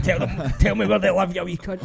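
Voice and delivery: playing a menacing bully for laughs; mock thug threat. Acting a threatening man.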